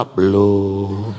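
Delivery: loud, low, drawn-out answer; re-enacted shout from the toilet; calling back. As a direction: holler it back and hold the last vowel.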